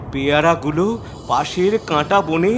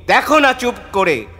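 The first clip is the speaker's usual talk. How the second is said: quick, higher reply; confident brush-off; 5 semitones higher, faster than usual. A narrator's reading. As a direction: toss it back fast, as an eager young hero. Child hero brushing off mother's warning.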